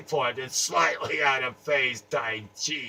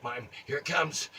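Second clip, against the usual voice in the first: usual speaking voice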